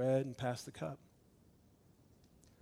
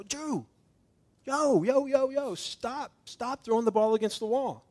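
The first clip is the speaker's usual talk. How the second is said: high, loud call across the house, stretching the yo's slowly; calling out to son; 8 semitones higher, 5 dB louder than usual. Retold or imitated. Father calls out to his son throwing a ball, high and loud. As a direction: yell to get a toddler to stop.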